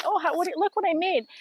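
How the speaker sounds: put-on high, eager like a proud kid showing off; mock childlike excitement; excited kid. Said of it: "I'm like".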